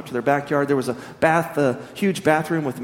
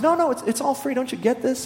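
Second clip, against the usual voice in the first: incredulous insisting; 9 semitones higher than usual. Acted. Peter repeats, incredulous.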